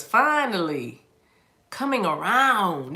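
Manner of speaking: slow and drawn out. Shown as a demonstration. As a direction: stretch it slow and pointed. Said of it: "I'm just gonna say it like this".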